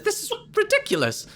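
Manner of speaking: squeaky high outrage; high indignant voice. Acted. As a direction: shriek it in disbelief. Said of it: "And he says".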